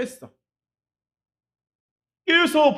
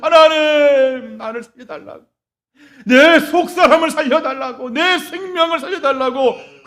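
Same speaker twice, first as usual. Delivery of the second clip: crying out to God; 6 semitones higher, 6 dB louder than usual. Acted. Acted prayer of crying out.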